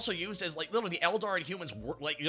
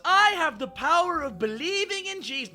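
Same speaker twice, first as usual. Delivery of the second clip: higher, louder preachy fervor; mock zealot; 12 semitones higher, 8 dB louder than usual. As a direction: proclaim it like a zealot. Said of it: "His whole thing was".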